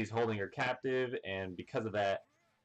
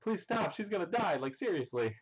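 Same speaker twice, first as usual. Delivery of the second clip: very high, squeaky pleading; mock pleading; 9 semitones higher than usual. Chiffon begging Sanji to stop before Pudding dies, very high pleading. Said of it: "Chiffon goes".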